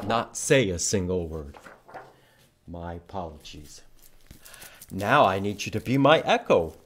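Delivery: usual speaking voice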